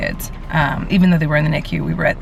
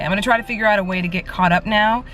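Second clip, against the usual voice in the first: ordinary delivery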